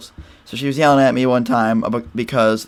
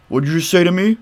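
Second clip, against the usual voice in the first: higher put-on voice of a menacing boyfriend; mock angry biker; 8 semitones higher than usual. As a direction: voice the boyfriend's challenge, pitched up. Mom's boyfriend confronting, acted quarrel.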